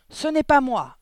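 high, very loud protest; outraged shriek of denial; yelled denial. A narrator's reading. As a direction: yell your innocence. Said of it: "hurla-t-elle".

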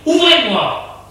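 high, drawn-out yell; crying out. A narrator's reading. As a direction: shout to the maid, slow and high. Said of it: "Il lui crie". (cri aigu et traînant)

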